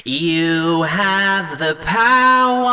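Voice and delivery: hammy cartoon villain roaring his line; Skeletor voice. Shown as a demonstration. Singer nailing the Skeletor vowel, nasal and slow. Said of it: "It's more like that".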